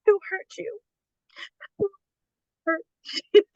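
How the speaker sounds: very high, halting impression; Peter Griffin. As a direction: squeak it out in broken pieces. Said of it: "And he's like".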